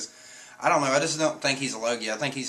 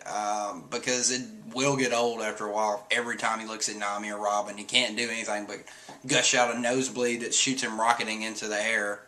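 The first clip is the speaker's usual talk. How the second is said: ordinary talk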